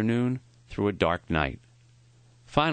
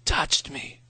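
whispered; 6 semitones higher than usual. A narrator's reading. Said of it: "he whispered".

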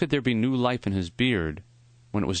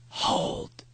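put-on weak dying old man. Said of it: "His voice was thin and raspy".

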